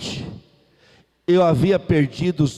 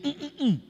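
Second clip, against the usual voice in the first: refusing hum; 8 semitones higher, 9 dB quieter, faster than usual. Retold or imitated. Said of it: "Sim, ele".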